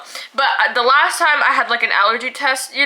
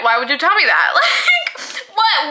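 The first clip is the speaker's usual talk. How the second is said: laughing disbelief; 4 semitones higher than usual. Real, not acted. Outraged retelling with laugh.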